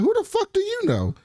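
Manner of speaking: high mocking voice for Rose, incredulous. Retelling Rose scoffing at Yaz, high and incredulous. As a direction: squeak it out in disbelief. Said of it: "And Rose is like".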